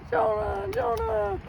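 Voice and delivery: high, slow sing-song call; mock high calling voice; Eddie calling Jonah. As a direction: stretch the name out like a taunt from afar. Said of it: "and he's like".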